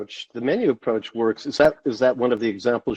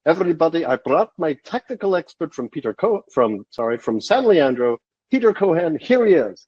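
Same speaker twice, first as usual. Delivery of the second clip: a lightly put-on Swedish accent, pitched up; mock Tori's Swedish accent, badly; terrible Swedish accent; 4 semitones higher than usual. Tori introducing his expert, hammed-up Swedish accent. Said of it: "I'm going to terribly do his accent".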